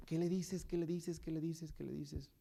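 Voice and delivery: repeated anxious question, fading away; a worried voice in his head that fades to nothing; fading inner urging. Retold or imitated.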